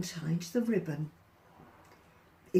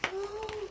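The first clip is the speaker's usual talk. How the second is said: sharp high gasp; shocked gasp; 11 semitones higher, 8 dB quieter than usual. Acted. Giant gasping as his trousers fall. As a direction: catch your breath in alarm.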